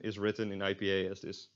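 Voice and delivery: usual speaking voice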